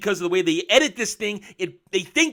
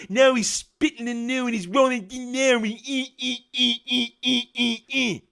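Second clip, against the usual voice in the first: higher, squeaky ee ee noises; mock droid squeaks; 5 semitones higher than usual. Reviewer imitating the droid rolling in, squeaky noises.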